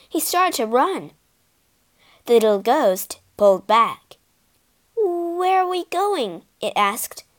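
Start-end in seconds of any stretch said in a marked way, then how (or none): 5.0-6.5 worried. A narrator's reading. Ghost pulls back, worried.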